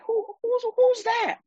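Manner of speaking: high-pitched, intrigued double take; acted-out curious stutter, pitched right up; smitten man noticing her. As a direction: stammer it high, as if suddenly struck by someone. Acting out Boaz first noticing Ruth.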